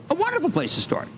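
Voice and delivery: wonderful lifted high, start landed hard. Shown as a demonstration. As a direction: build up to start. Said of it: "Emphasis on start".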